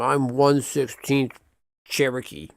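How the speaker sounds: slow, drawn-out mock boast of heritage; mock claim. Mocking people who claim to be one sixteenth Cherokee. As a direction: drag it out like a smug claim.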